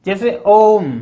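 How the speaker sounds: ओम lengthened, tone slightly changed; prolonged Om. Shown as a demonstration. Teacher showing a three-beat vowel, prolonged. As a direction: hold the vowel of ओम well past normal. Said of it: "इसको हिंदी के तीन से भी दर्शाते हैं".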